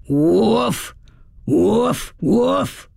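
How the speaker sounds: drawn-out human "woof", rising in pitch; imitation dog bark. A narrator's reading. Woman imitating Bo Peep to scare coyotes, imitation dog bark. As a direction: bark like a big guard dog, half sung upward. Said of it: "as loud and low-pitched as I could make it".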